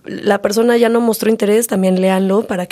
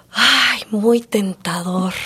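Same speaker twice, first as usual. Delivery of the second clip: acted-out tempted aside, stretched out; tempted musing; 4 dB quieter, much slower than usual. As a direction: muse on it slowly, as if tempted. Acts out own tempted reaction.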